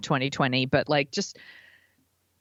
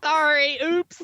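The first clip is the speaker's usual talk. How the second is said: high, louder mock oops; a guilty little apology; mock sorry; 10 semitones higher, 3 dB louder, slower than usual. Real, not acted. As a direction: playfully caught out. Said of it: "salty language".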